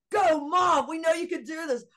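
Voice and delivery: loud, high mock cheer from her son; rally her like a son cheering from the car; retold cheering shout. Son urging mom to go inside, high loud cheering.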